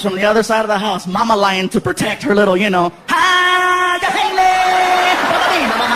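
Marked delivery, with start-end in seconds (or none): 3.1-5.9 high, loud wailing yell; war cry. Acted. Mama lion comes to protect her cub, loud high cry. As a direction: charge in with a long wild cry.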